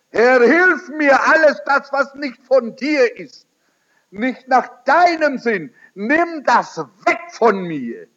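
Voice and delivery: high, loud pleading prayer; a new believer pleads loudly with the Lord for change; fervent prayer. Pretend. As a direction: beg the Lord with all your heart.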